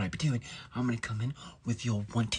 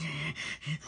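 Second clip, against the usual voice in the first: higher, softer strained cheer; mock gloating; 5 semitones higher, 6 dB quieter than usual. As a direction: relish the curse landing. Relishing the spell he cast.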